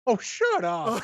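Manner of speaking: high, mock-annoyed brush-off; playful shut up. Real, not acted. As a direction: swat the teasing away. Co-host teased about laziness.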